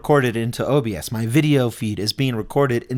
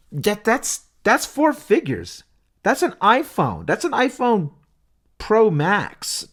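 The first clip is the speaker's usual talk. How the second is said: pitched way up in disbelief at the price; stunned, comparing the cost to a phone; incredulous; 8 semitones higher, slower than usual. Real, not acted. Podcaster balking at iPad Pro price, high incredulous disbelief.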